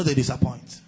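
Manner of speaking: ordinary talk, nothing marked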